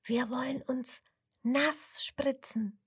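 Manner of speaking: soft, slow, voiceless whisper into an ear. Acted. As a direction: whisper it slowly like a secret. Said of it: "flüstert der Kuh ins Ohr". (geflüstert, langsam und leise)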